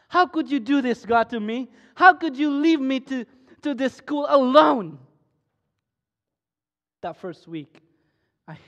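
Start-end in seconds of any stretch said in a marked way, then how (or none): 0.1-5.0 loud, higher accusing cry at God; angry at God. Retold or imitated. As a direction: hurl the question at God, loud and high. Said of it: "I was angry to God. I mocked God".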